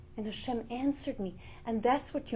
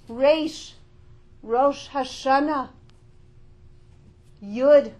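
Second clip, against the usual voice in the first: loud, higher, roaring sermon cry; preaching at full force; 4 semitones higher, 10 dB louder than usual. Acted. Preacher rousing the women to wake up, loud and fervent. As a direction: rouse the crowd like a roaring lion.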